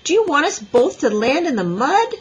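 loud, high-pitched exasperated scolding; ordering. A narrator's reading. Boy scolds girl while struggling through mud, exasperated. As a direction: argue back in exasperation. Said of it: "he ordered".

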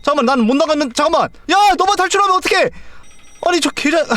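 high, rising panicked cry of protest; wait, don't leave me, voice cracking upward; frantic panic. Real, not acted. To the dog who escaped alone, frantic high cry.

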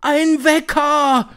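high, loud, drawn-out screech; slow, shrieked exclamation; shrieking. A narrator's reading. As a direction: screech it back in amazement. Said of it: "kreischten sie".

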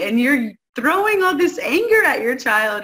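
ordinary talk, nothing marked

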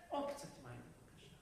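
low, hushed little request; meek mother. Acted. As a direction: trail down to a near-whisper. Mother's meek request for more water.

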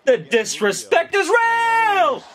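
high-pitched, drawn-out fan yell; hyped outcry. Real, not acted. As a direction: stretch the words out in high, amazed excitement. Elated fan reacting to a big shot.

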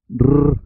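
long isolated tongue trill on "r"; double R sound. Shown as a demonstration. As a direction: say "r" as a bare vibrating rrr.

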